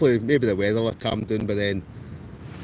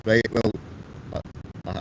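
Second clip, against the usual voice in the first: ordinary delivery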